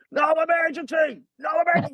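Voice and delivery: high mock shouting of no emergency; retold shout.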